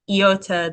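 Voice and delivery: iota with an "ee" start, "ee-OH-tuh". Shown as a demonstration. Teacher contrasting iota with Yoda, "ee-OH-tuh". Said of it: "When you say it".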